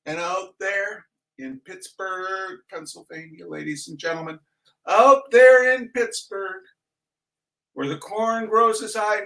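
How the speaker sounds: hams up a booming tribute; mock grand announcer. Host to Pittsburgh viewers, mock grand announcer.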